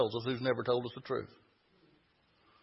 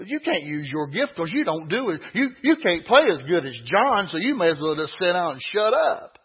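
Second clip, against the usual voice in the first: loud, pitched-up preacher voicing an accuser; accusing preaching; 5 semitones higher, 7 dB louder than usual. Preacher acts the secondary voice belittling believers, loud and accusing. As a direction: hammer out each accusation like a preacher in full flow.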